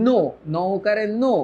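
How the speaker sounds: নো with a clear round o; syllable-by-syllable pronunciation. Shown as a demonstration. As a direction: sound out নো for dictation. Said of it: "এইটা লিখবো হচ্ছে".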